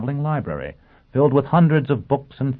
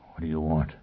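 low, soft, weakened murmur; whispered; 4 semitones lower, 7 dB quieter than usual. A narrator's reading. Drugged captive asking his attacker, whispering. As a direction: barely get the question out, hushed. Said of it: "Foyle whispered".

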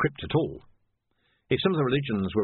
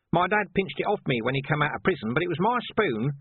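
higher pitched, insisting; persistent Nobby; 8 semitones higher than usual. A narrator's reading. Nobby insisting to Colon about his spoon, high. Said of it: "said Nobby persistently".

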